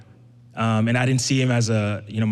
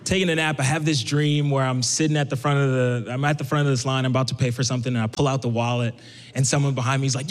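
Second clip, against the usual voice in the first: usual speaking voice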